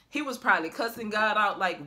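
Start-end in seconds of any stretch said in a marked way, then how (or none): none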